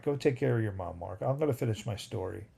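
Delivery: ordinary talk, nothing marked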